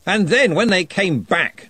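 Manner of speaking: jolly but menacing; threatening joviality. A narrator's reading. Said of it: "slightly threatening joviality".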